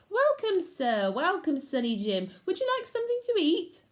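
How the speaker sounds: very high, bright welcome; cheery leader. Acted. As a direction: greet them sweetly and brightly. Leader greets warmly.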